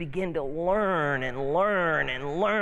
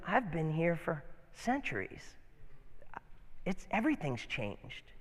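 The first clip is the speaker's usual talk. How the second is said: put-on softer, calm voice of an old tree; old oak; 8 dB quieter than usual. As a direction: speak quietly like an ancient oak. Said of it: "And the oak says to him".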